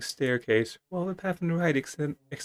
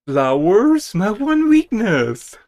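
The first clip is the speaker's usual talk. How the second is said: loud, high, slowed melodramatic lament; theatrical mock despair; mock dramatic; 7 semitones higher, 11 dB louder, much slower than usual. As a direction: ham up a fatal weakness. Mock dramatic weakness joke.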